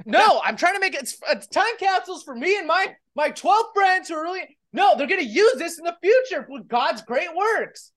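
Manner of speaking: put-on whiny kid brother. Kid brother whining to Mom.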